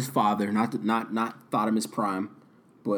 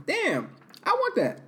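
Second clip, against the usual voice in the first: high wanting voice; slower than usual. Retold or imitated. Woman voiced wanting a man.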